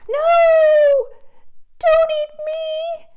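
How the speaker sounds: very high, loud crying plea; terrified plea. Acted. Little goat begging the troll not to eat him. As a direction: beg for your life.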